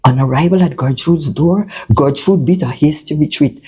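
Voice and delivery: usual speaking voice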